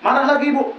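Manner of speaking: ordinary delivery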